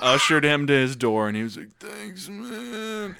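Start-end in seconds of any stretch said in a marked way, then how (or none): 1.8-3.2 high, very soft, feeble voice; sick buddy. Retold or imitated. Sick buddy ushered to his door, feebly thanking. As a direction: imitate a wiped-out friend's weak thanks.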